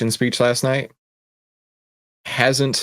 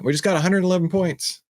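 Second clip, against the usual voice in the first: put-on higher-pitched calling up to the kids; retold call upstairs, pitched up without real volume; mild mock yell; 8 semitones higher than usual. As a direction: call out as if to another floor, lightly. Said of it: "I'd yell up to the kids".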